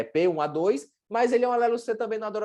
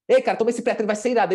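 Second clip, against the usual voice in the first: higher, eager sales push; hyped-up pitch; 3 semitones higher, 4 dB louder, faster than usual. Shown as a demonstration. Nutritionist sells patient an awesome pre-workout, enthusiastic. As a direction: hype it up to build expectation. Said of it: "Agora, se eu falar assim".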